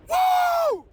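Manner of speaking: high, loud joyful whoop; ecstatic whoop. Real, not acted. Morning preacher whooping for his viewers, ecstatic whoop. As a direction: let out a wild happy whoop. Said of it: "when I get super excited".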